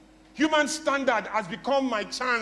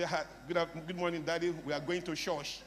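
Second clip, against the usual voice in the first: much softer, lower, hesitant greeting; acted meek reply; 7 semitones lower, 12 dB quieter than usual. Suitor answers girlfriend's father, meek and hushed. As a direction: play a timid suitor, voice dropped low.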